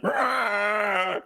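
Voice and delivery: imitated dinosaur roar; T-Rex roar. Retelling the trailer's T-Rex, mock roar.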